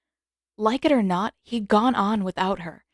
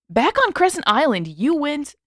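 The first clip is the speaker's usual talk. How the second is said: insistent brother; 7 semitones higher, 5 dB louder than usual. A narrator's reading. Sokka voiced, insistent.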